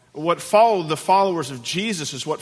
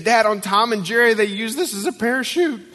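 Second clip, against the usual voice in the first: put-on high, louder, thrilled kid voice; giddy small-son voice, pitched up high; excited little boy; 8 semitones higher, 3 dB louder than usual. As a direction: imitate a child bursting with excitement. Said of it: "And he was so excited".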